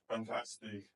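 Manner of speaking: ordinary talk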